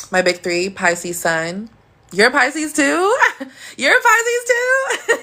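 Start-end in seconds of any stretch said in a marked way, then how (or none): 2.1-5.2 much higher, slowed, laughing disbelief; delighted surprise. Real, not acted. To a viewer who is also a Pisces, thrilled laughing surprise. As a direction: squeal it out, thrilled to find another one.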